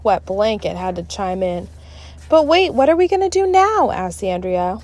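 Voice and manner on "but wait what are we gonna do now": worried. A narrator's reading. Worried kid Andrea.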